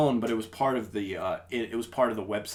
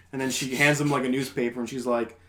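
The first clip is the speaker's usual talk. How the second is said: ordinary talk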